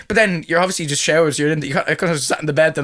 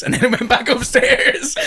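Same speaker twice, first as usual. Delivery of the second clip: high, slow words through laughter; laughing at his own sleepwalking; 10 semitones higher, slower than usual. Real, not acted. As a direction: chuckle it out.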